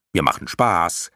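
plain reading voice

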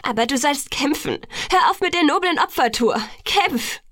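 high-pitched, insistent outburst; building to an impassioned command; urgent pleading. A narrator's reading. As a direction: urge him fiercely to fight. Pleading outburst urging him to fight.

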